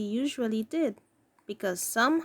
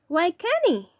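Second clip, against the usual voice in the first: mild surprise; 7 semitones higher, 6 dB louder than usual. Acted. Aunt May surprised.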